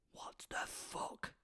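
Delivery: silent whisper, slow; mouthed. Retold or imitated. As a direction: mouth it without voice.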